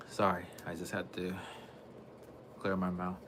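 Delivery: real whisper after clearing his throat; whispered apology. Real, not acted. Streamer whispering sorry to viewers after clearing throat. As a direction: breathe it out, no voice.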